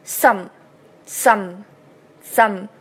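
"some" with a voiceless s and a hummed m; "some" closing on a clear nasal m; clear S, nasal ending. Shown as a demonstration. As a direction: repeat "some" slowly as separate model words. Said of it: "S的清辅音s和嗯的鼻音".

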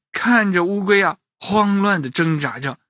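ordinary delivery

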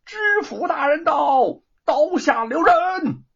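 a herald calling out to stop the execution; drawn-out high call. A narrator's reading. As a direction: stretch the call out across the square. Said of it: "有人再一次的高喊".

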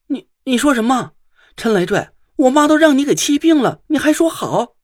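high, loud indignant outburst; furious, near tears. A narrator's reading. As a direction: stammer in disbelief, then climb into angry shouting. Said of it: "宁珂气得一声就哭了起来".

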